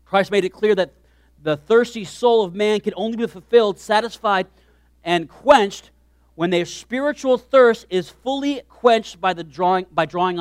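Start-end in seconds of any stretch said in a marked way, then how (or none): none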